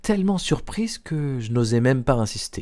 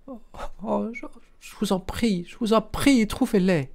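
high-pitched, faltering cry of suffering; anguished plea; 9 semitones higher than usual. A narrator's reading. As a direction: beg desperately in a high, pained voice. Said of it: "une sorte de cri de souffrance". (supplication aiguë, cri de souffrance)

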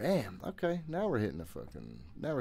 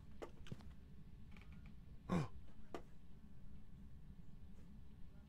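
long frightened gasp; 11 semitones higher, 14 dB quieter than usual. Real, not acted. Ghost event continues.

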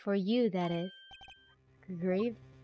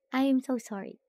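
normal talking voice

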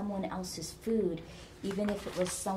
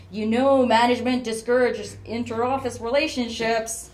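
loud, higher, singing-out tease; joshing; 5 semitones higher, 11 dB louder than usual. A narrator's reading. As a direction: tease the pair loudly. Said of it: "Darren joshed".

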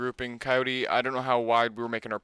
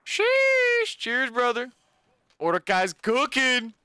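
high, excited shout-out; hyped; 12 semitones higher, slower than usual. Real, not acted. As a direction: hype up the donor. Hyped by gifted subs.

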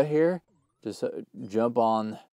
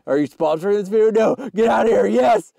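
loud, high, rising to a shout; excited shouting; 10 semitones higher, 7 dB louder than usual. Pretend. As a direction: build from a quick question to a yelled yes. Mock excited exchange with a sponsor.

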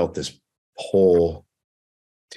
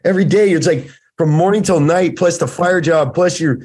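much higher and louder, gushing praise; excited hype; 10 semitones higher, 5 dB louder than usual. Retold or imitated. Wes hypes up his friend, listing everything he does, excited high and loud. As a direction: rattle it off like an amazed friend hyping you up. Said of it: "He's like".

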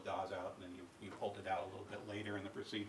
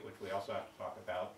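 usual speaking voice

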